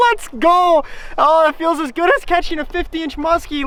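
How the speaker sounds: real elation; ecstatic cheer. Real, not acted. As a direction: rattle it off overexcited. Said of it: "Listen to my voice".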